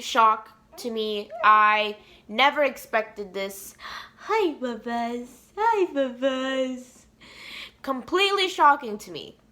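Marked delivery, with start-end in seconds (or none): 4.2-7.1 slow, drawn-out cooing greeting. Real, not acted. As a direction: coo hello in long stretched vowels. Breaks off to greet babies or pets.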